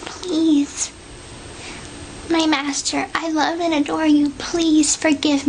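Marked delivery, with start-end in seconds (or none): 0.1-0.9 breathy whispered begging; whispered plea. Real, not acted. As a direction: whisper it, pleading.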